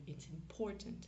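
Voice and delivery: English "important" stressed on POR; accent tonique au milieu. Shown as a demonstration. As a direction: hit the middle syllable of "important", English style. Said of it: "sur la syllabe du milieu". (« important » à l'anglaise, accent sur la syllabe du milieu)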